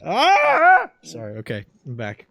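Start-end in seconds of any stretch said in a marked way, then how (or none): 0.0-0.9 disgusted yell. Real, not acted. Shakes off the voice.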